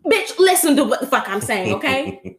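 sassy [name]. Retold or imitated. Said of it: "She was like".

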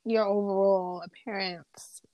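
ordinary talk

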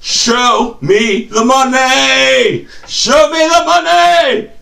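high movie-quote holler, drawn out; hammed-up rallying cry, rising in pitch; Jerry Maguire. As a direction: belt it out, stretching the last word. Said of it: "Cuba Gooding Junior and Jerry Maguire".

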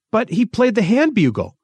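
high-pitched objection; protesting. A narrator's reading. Thistle disputing Rolver's identification of the goblin, protesting. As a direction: object in disbelief. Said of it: "Thistle protested".

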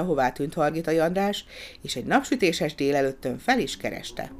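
plain reading voice

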